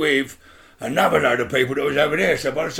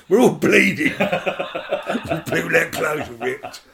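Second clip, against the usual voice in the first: laughing; 3 semitones higher, much slower than usual. Real, not acted. Laughing while recalling aftermath.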